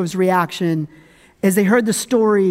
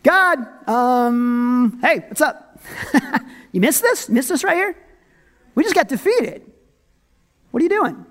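high-pitched, incredulous complaint; mock blaming; 3 semitones higher than usual. People blame God for their defeat, mock blaming. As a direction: hail God as if He'd missed something. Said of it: "how quick are we to blame God".

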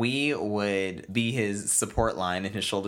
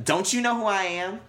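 much higher, louder indignant whine; much higher, louder spoiled celebrity; entitled outrage; 10 semitones higher, 3 dB louder than usual. Retold or imitated. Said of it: "Would you say it like that".

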